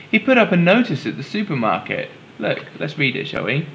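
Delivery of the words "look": usual speaking voice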